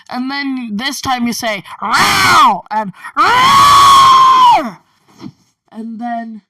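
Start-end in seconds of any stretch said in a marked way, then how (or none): 1.8-2.6 loud, high screeched roar; full-voiced creature yowl; dragon screech. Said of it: "the screeches were over". 3.2-4.8 very high, long piercing screech; ear-splitting screeched roar; dragon screech. Acted. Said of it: "the screeches were over".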